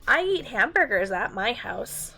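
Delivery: ordinary delivery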